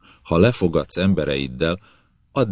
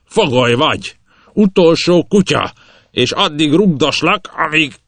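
high, loud screaming rage; captain screaming curses while kicking; furious yell; 12 semitones higher, 8 dB louder than usual. Acted.

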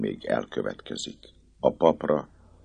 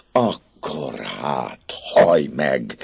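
slow, strained, teeth-gritted threat; through gritted teeth; 3 dB louder, much slower than usual. Acted. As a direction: grind it out between clenched teeth. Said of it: "felelte fogcsikorgatva". (fogcsikorgatva, vontatottan)

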